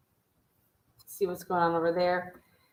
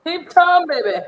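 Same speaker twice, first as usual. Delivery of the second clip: higher, louder cheer; rally the crowd; 9 semitones higher, 11 dB louder than usual. Real, not acted. Cheering on the friend.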